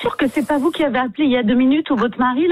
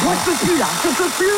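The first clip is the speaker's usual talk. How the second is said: higher, worn-out protest; exasperated; 5 semitones higher than usual. Real, not acted. Receptionist who can take no more, exasperated. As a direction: protest as if at breaking point.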